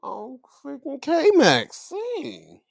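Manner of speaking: high, loud, slow drawn-out reaction; stunned excitement. Real, not acted. Vlogger reacting to viewers about the surprise champion, loud and high. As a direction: let it out slowly, loud and high.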